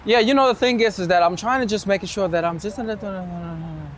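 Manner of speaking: starts up then fades to almost nothing; voice falling away. Shown as a demonstration. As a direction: let the voice die out at the end. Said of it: "when your voice is actually about to fall".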